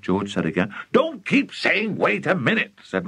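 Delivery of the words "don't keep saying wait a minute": exasperated father cutting in; impatient. Acted. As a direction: scold him, stretching the words. Excited father cuts son off.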